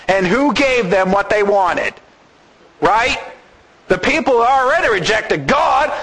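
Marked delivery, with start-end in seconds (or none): none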